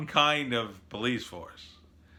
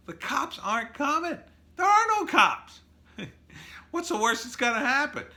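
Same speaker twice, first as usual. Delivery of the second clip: much higher, mocking glee; gleeful robber. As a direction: crow it like nobody can stop you.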